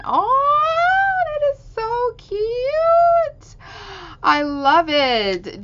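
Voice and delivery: very high, slow, drawn-out "Oh, that is so cute"; delighted squeal. Real, not acted. Hearing about the boyfriend's gift, squealing with delight. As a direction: melt with delight at the sweet story. Gushing over gift story.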